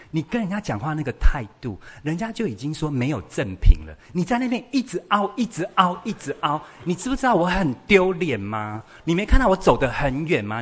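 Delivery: high, loud, piling-up accusations; exasperated rant. Acted. Child complaining about mother at the department store, exasperated. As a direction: play an embarrassed child venting at full tilt.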